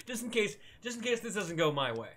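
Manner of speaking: amused, high and giggly start; laughing. Real, not acted. Laughs while saving as precaution.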